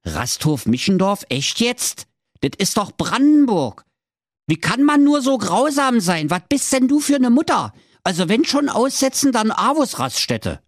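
high-pitched, climbing outrage; indignant husband. Acted. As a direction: protest in mounting disbelief as the husband. Husband indignant at wife's cruel plan.

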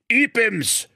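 high, grand bishop's proclamation; bishop announcing. Acted. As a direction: burst in announcing himself. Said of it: "stand in der Türe und rief". (hoher, feierlicher Bischofsruf)

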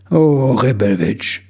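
slow, drawn-out sigh of the big boss. A narrator's reading. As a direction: let the name out as a long sigh. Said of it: "seufzte er". (langsam und seufzend)

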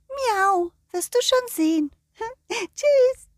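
high meowing cat voice. Acted. The cat scolds the dog for being loud, meowing cat voice. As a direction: speak like a fussy cat, meow and all.

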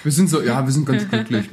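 usual speaking voice

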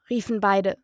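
plain reading voice

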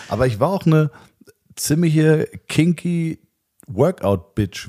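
kinky and Workout-Bitch slowed right down; drawn-out emphasis. Acted. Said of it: "würde ich mich bezeichnen".

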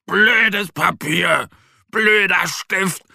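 high, slow, drawn-out furious cries; angry yelling. A narrator's reading. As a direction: stretch out each angry outburst, high-pitched. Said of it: "schreit Mo und stampft vor Wut".

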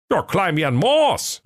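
high-pitched grumbling sleeper-car conductor; comic high fool griping; high sulky conductor. Acted. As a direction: whine about the job in a high silly voice. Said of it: "rief der Schwerthelm".

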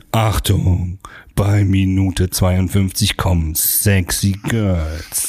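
deep, slow movie trailer announcer. Shown as a demonstration. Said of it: "dann nehmen wir es jetzt auf". (tiefe, langsame Trailerstimme)

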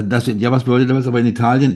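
usual speaking voice

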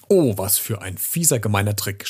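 ordinary delivery

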